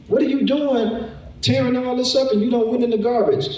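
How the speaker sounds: very high, exasperated scolding; angry pet owner. Retold or imitated. Owner scolding the pet for tearing up the garbage, very high and exasperated. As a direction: scold the pet like it's a person.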